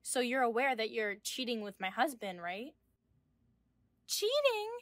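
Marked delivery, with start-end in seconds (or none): 4.1-4.7 high, incredulous scoff; scoffing disbelief. Acted. Mistress throws the word cheating back, scoffing. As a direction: toss the word back, affronted.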